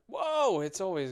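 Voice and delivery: loud, high-pitched whoa of surprise; surprised. Real, not acted. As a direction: blurt it out, startled by the time. Startled on checking the time.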